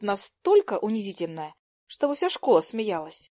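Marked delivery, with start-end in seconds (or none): none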